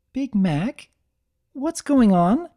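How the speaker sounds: high, worried question; Twilight voice. Acted. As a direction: play a horrified young mare.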